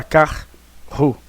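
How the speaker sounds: "carro" split as car; separação gramatical. Shown as a demonstration. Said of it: "você não vai falar".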